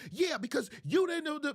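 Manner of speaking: high, accusing comeback; acted bickering; arguing wife. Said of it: "What I mean by arguing".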